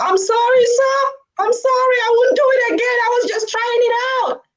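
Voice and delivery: high-pitched mock whimpering apology; pleading frightened child. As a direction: beg like a scared kid, very high voice. Scared kid begs officer's forgiveness.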